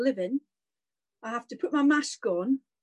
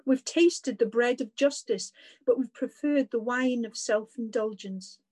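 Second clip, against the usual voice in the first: prayer. Acted. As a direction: pray it aloud, measured and reverent. Leads a spoken prayer.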